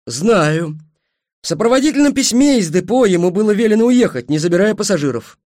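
loud, high, defensive explanation; irritated retort. A narrator's reading. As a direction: fire the facts back heatedly. Angry driver answers back. (раздражённо, громко и высоко)

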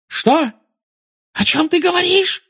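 very high, panicked squeal; shrieking. Acted. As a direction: squeal it, voice leaping up. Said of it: "взвизгнула она".

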